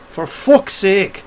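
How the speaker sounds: drawn-out mock Geordie; Geordie accent. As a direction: stretch it out like a Geordie. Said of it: "Geordie mates would say".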